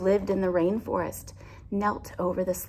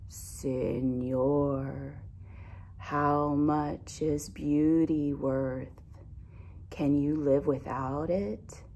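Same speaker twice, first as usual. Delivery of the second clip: lower, slow and sleepy; deep lazy sloth voice; 4 semitones lower, 3 dB quieter, slower than usual. Acted. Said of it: "she spoke in her deep and lazy voice".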